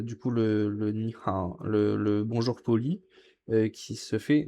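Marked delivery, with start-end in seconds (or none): none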